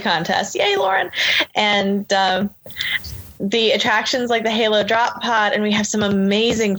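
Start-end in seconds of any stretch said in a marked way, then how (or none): 0.5-1.1 high-pitched little cheer. Real, not acted. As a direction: give a quick, high cheer for a friend. Cheers a named person mid-list.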